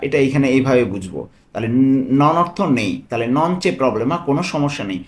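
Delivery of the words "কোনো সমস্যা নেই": normal talking voice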